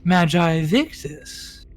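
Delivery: high, drawn-out flirty greeting; coy queen voice. Acted. Fish queen flirting with the mage Victus. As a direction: purr his name slowly, flirting. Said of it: "she'll go".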